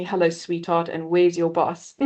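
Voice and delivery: ordinary talk, nothing marked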